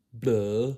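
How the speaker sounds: loud, drawn-out mock "blah"; exaggerated loud blah; silly blah. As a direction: blurt it out as a silly noise. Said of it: "you don't sound like that".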